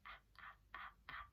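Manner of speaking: tiny whispered sounds; very soft, hushed repetitions; quietly. Shown as a demonstration. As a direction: whisper each one, barely audible. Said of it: "Say it quietly".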